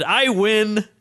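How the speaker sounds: mild mock shout, pitched high; retold triumphant shout. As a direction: call it out in victory, without real volume. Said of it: "So I shouted".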